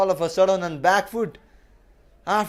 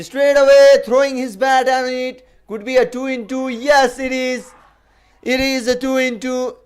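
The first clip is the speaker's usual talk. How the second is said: excited; 6 semitones higher, 5 dB louder than usual. Real, not acted. Wicket falls, live call.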